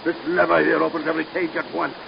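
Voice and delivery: usual speaking voice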